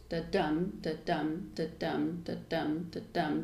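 counting out iambic feet aloud; iambic beat. Shown as a demonstration. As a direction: tap out each "da-dum" like a metronome. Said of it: "Did you see me counting?".

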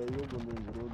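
normal talking voice

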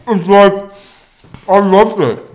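loud, high burst of delight; excited. Real, not acted. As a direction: gush about it with joy. Raving about the food.

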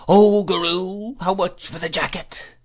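put-on high, loud old man's wail; crazed old dealer's high singsong; whining cry. A narrator's reading. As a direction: swell on a long "Oh", then whine the question. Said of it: "delivered in a sort of tune".

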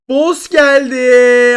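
slow, stretched, loud words; drawn-out repeat. Real, not acted. Gamer repeating it for viewers, slowly stretched. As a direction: drag each syllable out.

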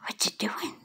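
very soft hushed whisper. Real, not acted. To the cat, very soft whisper. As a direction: barely whisper it.

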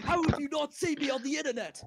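high, loud, hammed-up indignant yell; mock outrage. Imagined YouTuber to a fan who doesn't know him, mock outraged yell. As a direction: berate the fan in pretend fury, half laughing.

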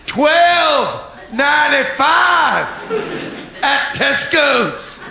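high shouting market trader; hammed-up market seller yell; meat van hawker.

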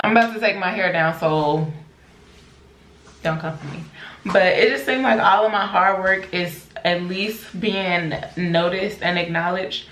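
ordinary talk, nothing marked

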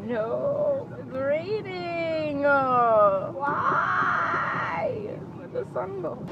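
soft, drawn-out whine; plaintive, long-drawn lament; mock wail. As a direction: moan it slowly, stretching each word. Whines about sudden rain.

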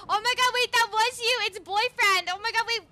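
loud, high squeal of recognition; excited. Real, not acted. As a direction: gush as it suddenly clicks. Recognizes viewer's avatar on stream.

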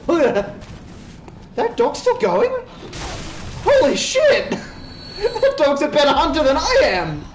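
very high, loud astonished laughter; amazed laughing shout. Real, not acted. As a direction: shriek with delighted disbelief.

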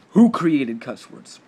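loud, slow, incredulous rant; exasperated outburst. Real, not acted. Teen ranting to his viewers, loud and exasperated. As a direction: demand it of the world, fed up.

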